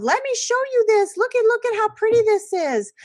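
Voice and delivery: very high, bright sing-song; distracting sing-song. Teacher mocking a parent distracting a child, high sing-song. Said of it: "don't come from a place of".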